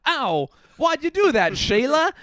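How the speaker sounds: a hammed-up Australian bloke, hurt; mock Aussie man. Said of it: "he'll go".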